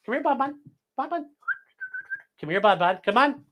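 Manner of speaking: high sing-song call to the dogs; pet-talk coaxing. Real, not acted. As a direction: coax the dogs over in a high coo. Calling dogs to come over.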